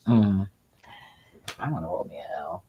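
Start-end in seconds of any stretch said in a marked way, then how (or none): 1.6-2.6 quick whispered aside. Real, not acted. Muttering to herself mid-rant, whispered. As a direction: slip it out in a whisper. Aside under her breath.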